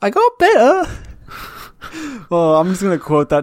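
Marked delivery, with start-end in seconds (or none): 0.0-0.8 high, loud sheepish peasant; Monty Python peasant voice. Acted. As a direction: sheepishly squeak the punchline. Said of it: "Monty Python".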